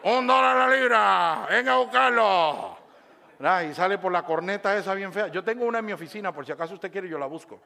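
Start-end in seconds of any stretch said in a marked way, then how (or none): 0.0-2.8 loud drawn-out vendor's cry; slow, blaring hawker through a bullhorn; street vendor on a megaphone. Acted. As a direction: call out your wares to the whole street. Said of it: "la bocina esa que usan en nuestros países".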